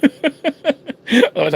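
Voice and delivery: ordinary delivery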